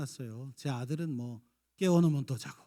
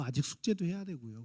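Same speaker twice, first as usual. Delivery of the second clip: ordinary talk, nothing marked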